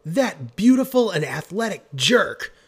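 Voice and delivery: high, loud outburst; indignant shout. A narrator's reading. Marcy Marcy blaming Creed for the threat, shouting. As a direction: shout it in outrage. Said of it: "shouted Marcy Marcy".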